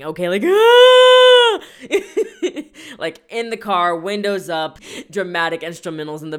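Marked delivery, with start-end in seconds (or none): 0.4-1.6 loud, high, hammed-up wailing sob; mock ugly-cry wail. Podcaster acting out ugly crying in the car, hammed-up wail. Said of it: "I'm talking about ugly whale crying".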